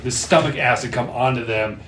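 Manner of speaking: ordinary talk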